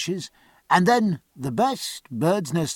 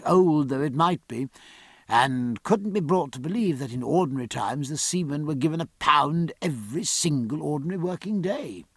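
usual speaking voice

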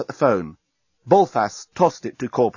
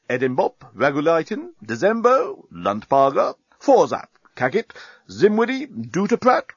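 high-pitched military roll call; each name lifted high, like an announcement; captain presenting his troops; 9 semitones higher, 3 dB louder than usual. A narrator's reading. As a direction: reel off names like a captain on parade.

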